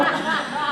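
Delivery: soft laugh. Real, not acted. Laughs at herself with group.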